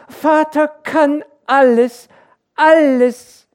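sehr hoch, laut und gedehnt; jubelndes Kind. A narrator's reading. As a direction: begeistert wie ein kleines Kind ausrufen. (kindlicher Jubel)

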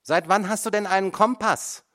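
high, loud, scornful question; mocking. A narrator's reading. Mother mocks her son in court, scornful and loud. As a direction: sneer at him with the question. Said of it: "es klingt wie Hohn".

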